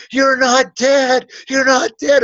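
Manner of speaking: high, loud, slow panicked cry; freaking out. Retold or imitated. Man on the ground seeing him alive, freaking out. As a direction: imitate a man in horrified disbelief. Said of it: "starts freaking out, going".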